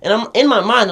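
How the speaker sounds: ordinary talk, nothing marked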